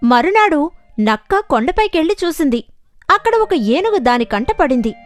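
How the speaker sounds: ordinary delivery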